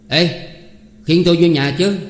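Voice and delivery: loud, high, commanding call; loud and firm. Acted. Sáu ordering the crowd to carry him inside, bold loud command. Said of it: "rồi dõng dạc".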